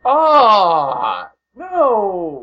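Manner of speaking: high, loud anguished no; dismayed cry. Real, not acted. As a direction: wail at the bad news. Dismay at booking news.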